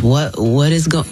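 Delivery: usual speaking voice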